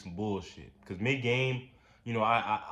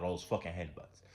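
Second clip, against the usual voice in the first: ordinary talk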